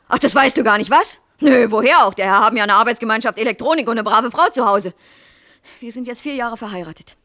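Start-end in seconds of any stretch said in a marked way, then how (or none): 5.8-7.2 soft, held-back statement; quiet reproach. Acted. Wife reminding husband of their marriage, quiet reproach.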